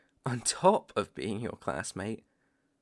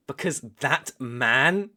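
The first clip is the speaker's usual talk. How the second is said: slow and louder, hang on man; loud emphatic question; 3 semitones higher, 7 dB louder, slower than usual. Acted. Hunter works up to naming the ancient vampire, slow loud question. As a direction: press the question hard, slowly.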